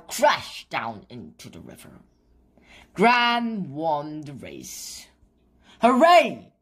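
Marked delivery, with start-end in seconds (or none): 5.8-6.5 high, loud cheer. A narrator's reading. The children cheer Gran's win, loud and high. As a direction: shout hooray in triumph. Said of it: "called Biff and Chip".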